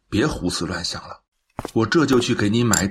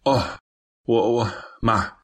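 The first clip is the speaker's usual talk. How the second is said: hesitant stammer. Acted. Son questioned by his mother about the delay, stammering. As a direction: stall for words, caught off guard.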